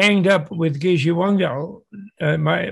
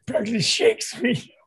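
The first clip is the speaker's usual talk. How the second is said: ordinary talk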